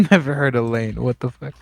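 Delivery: normal talking voice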